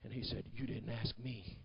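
low, very soft storytelling; hushed story narration. Acted. Preacher telling how Daddy pulls out the rock, low and hushed. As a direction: bring it right down for the quiet reveal.

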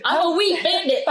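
high, silly villain confession; mock bandit. Co-host joking as a grain thief, high silly bandit voice.

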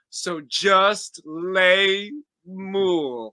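very slow, drawn out; slow drawn-out punchline. Pretend. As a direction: stretch out the last line.

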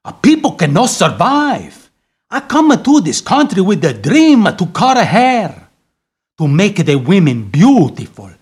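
put-on heavy Italian accent. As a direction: talk like an Italian barber. Italian barber throughout.

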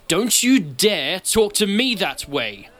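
slow, high, loud callous shouting. A narrator's reading. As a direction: shout over someone, drawing out the threat. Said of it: "a callous voice shouting over the top".

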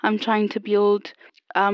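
ordinary delivery

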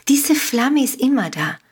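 usual speaking voice

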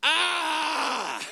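sudden high shout of frustration; raw prayer cry. Retold or imitated. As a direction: let out one loud wordless cry. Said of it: "This is what it was, word for word".

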